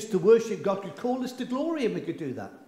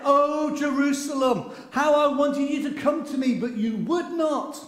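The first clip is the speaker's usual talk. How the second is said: lament; 9 semitones higher, 5 dB louder than usual. Acted. Preacher voicing Jesus's lament.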